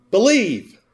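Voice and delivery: high-pitched poster slogan with an exclamation mark; exclamatory. Shown as a demonstration. Preacher quoting a motivational poster to the congregation, exclamatory. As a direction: exclaim it high, then let it fall. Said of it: "with an exclamation mark".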